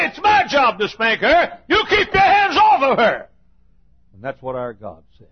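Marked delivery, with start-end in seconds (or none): 0.1-3.3 high, loud warning from dad; stern father warning. Retold or imitated. Dad warning his son to keep off, loud stern rebuke. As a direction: bark it like an angry father. Daddy warns son off sister.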